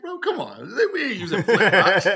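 laughing mock outrage at a tomahawk class; incredulous mockery. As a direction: scoff at the idea like it's absurd. Mocking a friend's tomahawk class.

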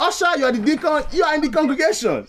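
put-on lightly Nigerian-accented English, rolling the vowels; Nigerian pastor accent. Pastor greets the congregation in a Nigerian accent. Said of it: "give us that accent".

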